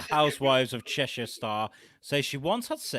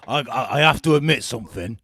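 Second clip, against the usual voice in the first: loud Liverpudlian twang; Cheshire accent; 8 dB louder than usual. Acted. Quoting the reality star's confession, mock Cheshire accent. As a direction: announce it in a put-on Cheshire accent. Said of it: "I was gonna say a bit Liverpudlian".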